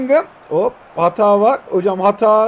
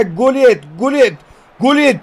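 each Gullit louder and higher into a shout; building excitement; 4 semitones higher, 4 dB louder, slower than usual. Real, not acted. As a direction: commentator winding up to a goal call. Building goal commentary call.

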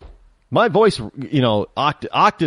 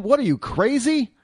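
much higher, disbelieving mock reply; incredulous retort; 9 semitones higher than usual. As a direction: toss it back as if the question is absurd. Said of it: "He's basically telling him".

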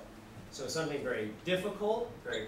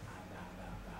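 put-on tiny, very soft baby babble; baby babbling; 10 semitones lower, 12 dB quieter, much faster than usual. Baby copying the father's babble, tiny and very soft. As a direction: coo it like an infant copying its father. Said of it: "and the baby goes".

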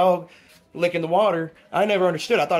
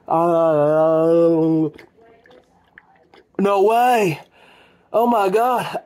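amazed outburst; 6 dB louder, much slower than usual. Real, not acted. Reacting to the dog drinking.

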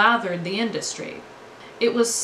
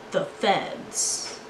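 "the feds" breathed in an unvoiced whisper; whispered punchline. Shown as a demonstration. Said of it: "the feds were not".

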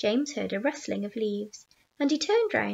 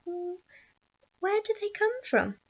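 put-on timid boy; 8 semitones higher than usual. A narrator's reading. Frightened boy asking.

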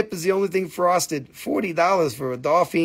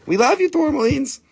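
cooing; 10 semitones higher than usual. Acted. Cooing to the crystals.

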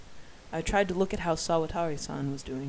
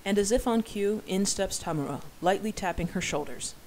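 ordinary delivery, nothing marked

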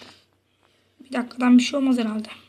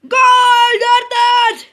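high loud goal shout; goal scream; 10 semitones higher, 8 dB louder, much slower than usual. Real, not acted. Scoring a goal.

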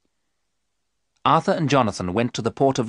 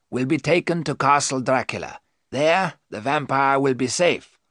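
explaining professor; 6 semitones higher than usual. Acted. Professor explains vampire limits to his friends, emphatic.

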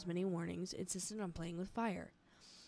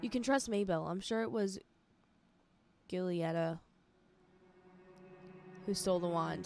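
mild shout; 3 dB louder than usual. Acted. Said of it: "shouted Maybelline".

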